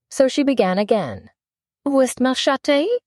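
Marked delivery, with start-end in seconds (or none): 1.9-3.0 schoolgirl French phrase, pitched high; a child trying out her French on a mouse. Acted. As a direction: recite the French lesson-book line in French. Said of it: "the first sentence in her French lesson book".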